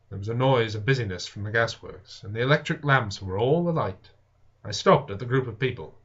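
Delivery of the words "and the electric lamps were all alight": usual speaking voice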